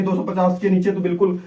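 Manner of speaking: normal talking voice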